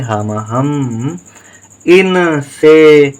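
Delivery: हा माँ हम and इन से drawn out slowly; teacher sounding out syllables slowly for dictation; slow spelling out. Shown as a demonstration. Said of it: "के साथ कोई उच्चारण नहीं".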